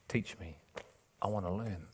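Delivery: very soft, low, teachable; humble. Acted. Preacher showing a humble listener asking to learn, soft and low. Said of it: "humble, you're quick, you're repositioned".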